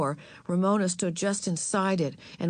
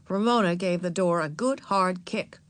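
ordinary delivery, nothing marked

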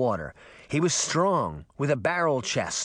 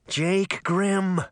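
lightly gurgled, higher fish voice; a hint of gurgle, pitched up; gurgling fish voice; 6 semitones higher than usual. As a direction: gurgle it like an irritated talking fish. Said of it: "said in a gurgling voice".

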